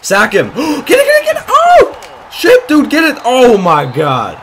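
high, loud screamed urging with a gasp; real frenzied gamer shout; frantic shouting. Real, not acted. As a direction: yell at the players mid-play, then cry out. Frantic chance to sack quarterback.

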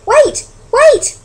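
high, loud interruption; piercing, urgent call. Acted. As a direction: cry out to halt them. Mother bat calls through the crowd.

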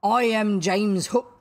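loud, slow, grand captain voice; mimicking Hook. A narrator's reading. Boy claims to be the captain, mimicking. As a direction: announce yourself as the captain, booming. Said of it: "in Hook's voice".

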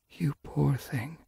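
soft, high, slow sympathy; whisper. A narrator's reading. Sage Whisker pitying Yellowfang, in a soft whisper. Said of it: "she whispered".

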